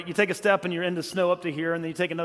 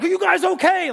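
much higher, louder alarmed call; freaking out; 11 semitones higher, 6 dB louder than usual. Retold or imitated. Stranger shouting to the sledders after they hit a tree, high and alarmed. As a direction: imitate a panicked stranger calling out. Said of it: "he was freaking out".

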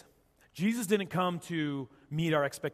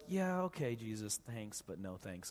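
imitated brush-off, sinking low and soft; politely unconvinced, voice dropping away; dismissive; 6 semitones lower, 9 dB quieter, slower than usual. Mary to Jesus about seeing Lazarus again, quietly dismissive. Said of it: "Just kind of this, like".

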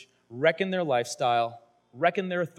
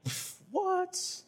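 mock disbelief; 7 dB quieter than usual. Mock reaction.

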